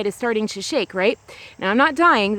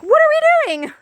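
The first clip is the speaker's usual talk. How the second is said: much higher and louder, a mock-panicked squeal; scared squeal; 6 dB louder than usual. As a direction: shriek it like the ground just gave way. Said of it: "And I was really scared".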